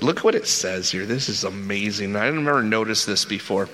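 ordinary delivery

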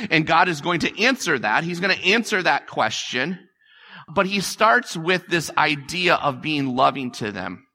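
usual speaking voice

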